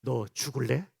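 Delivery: low, soft menacing mutter; low hushed mock threat; mock threatening whisper. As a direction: threaten quietly like an annoyed parent. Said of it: "귓속말 하라니까 이런 분들이 계셔요".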